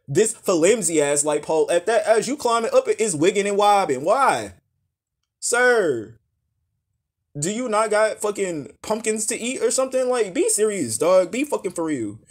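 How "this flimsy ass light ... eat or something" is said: ordinary talk, nothing marked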